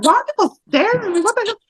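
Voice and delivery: high, quick bewildered question; mock surprise. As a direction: play it baffled and a little outraged. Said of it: "I'm like".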